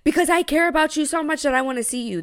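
retold indignant comeback; heated retort. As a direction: replay the argument with rising indignation. Re-enacted argument with boyfriend.

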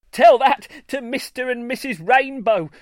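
high, loud gleeful taunt; cackling. A narrator's reading. Sly Greenstar taunting the doubter, cackling. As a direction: crow it with sly glee. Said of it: "Greenstar cackled".

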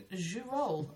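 "Jus roll" said zhoo-roll, French-style; soft zh and silent t in "Jus roll"; mispronounced brand name. Retold or imitated. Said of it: "thought it was called Jus Roll".

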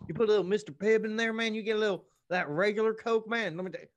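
mocking Dalton voice. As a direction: ham up a dopey good-old-boy buddy. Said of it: "He's like".

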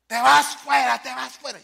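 high, shouted mock exorcism; acted exorcism command. Exorcist orders demon out, high mock shout. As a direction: cast it out in a high yell, easing off.

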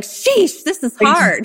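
high exclaimed sheesh, dropping afterwards; mock exasperation. Host showing what people say when stuck, exasperated. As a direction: exclaim it as if the task is too hard. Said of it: "what we say when something's hard".

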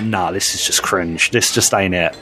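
dismissive. Retold or imitated. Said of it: "I'm like".

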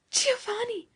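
high-pitched cry of his name; stunned, high exclamation; shocked exclamation. A narrator's reading. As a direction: cry out thunderstruck, voice leaping up. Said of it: "exclaimed Beatrice".

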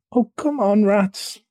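high, mildly impatient whine; pleading impatience, pitched high. Acted. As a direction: nag a friend to hurry up. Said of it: "mole waited impatiently, remarking at in-, intervals".

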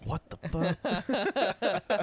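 put-on girlfriend's disbelief. Friend imitating the girlfriend staring at him cursing, slow mock disbelief. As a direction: stretch it out like a baffled girlfriend.

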